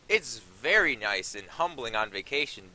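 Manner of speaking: usual speaking voice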